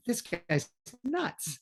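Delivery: drawn-out sceptical aside; mock incredulous thought. Family watching the ankle test, a hushed incredulous thought. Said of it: "and they think".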